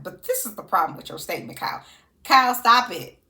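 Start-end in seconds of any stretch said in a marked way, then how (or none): 2.2-3.2 loud, exasperated telling-off; mock scolding. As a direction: call her out loudly, half joking. Scolding Kyle on the show.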